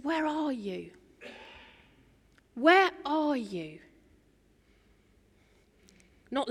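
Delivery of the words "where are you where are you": soft, tender, slow call; softly longing to find them; quiet, loving voice of God calling; gentle calling. Acted. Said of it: "He says".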